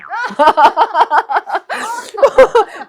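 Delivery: high, sustained burst of laughter; long, helpless high laugh; real laughter. Real, not acted. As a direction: crack up laughing. Cracks up at husband's two-word reply.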